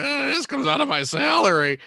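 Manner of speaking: high grumbling aside; mock grumble. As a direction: mutter it behind his back. Same line imitated higher.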